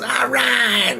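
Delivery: high, shrill-pitched all right; mock scream. Woman screaming at the police, imitated high. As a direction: scream it back at the cop. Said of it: "She screams at the cop".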